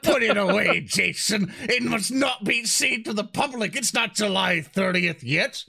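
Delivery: high, hammed-up dramatic warning; mock alarm. Player warns Jason to hide the unreleased book, mock alarm. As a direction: scold him with grand mock urgency.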